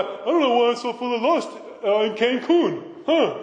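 hammed-up cluelessness; clueless partygoer. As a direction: play dumb, mimicking a baffled partygoer. Mimics clueless partygoers wondering why.

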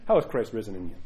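ordinary talk, nothing marked

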